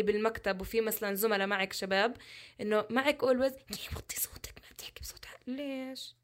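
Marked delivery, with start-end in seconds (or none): 2.6-3.6 whispered. Retold or imitated. Whispered line before colleague quote. 3.7-6.2 whispered, much softer scolding; hushed quote. Retold or imitated. Quoting colleague telling her hush.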